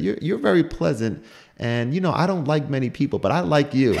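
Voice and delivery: a hint of the old teacher, high; old lady quote. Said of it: "She said".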